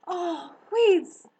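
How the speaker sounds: high, loud cry of relief; joyful cry. Acted. Girl finds her hare friend, crying out. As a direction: exclaim it in delighted surprise. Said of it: "cried Harriet".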